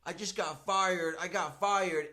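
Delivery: loud, high outburst, retold with full energy; imitating hyped-up frustration. Fired kid telling family in car, imitated hyped-up. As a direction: burst out with the news, acting the hothead. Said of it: "with all the energy".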